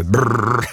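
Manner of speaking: trilled "brrr" buzz; demo massage sound. Shown as a demonstration. DJ showing listeners massage level six, buzzing demo. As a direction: buzz the lips like a strong massager. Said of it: "육 단계".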